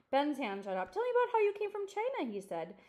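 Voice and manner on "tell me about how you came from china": put-on a lightly higher, louder eager boy; light child voice, keen to hear. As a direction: ask like an excited kid.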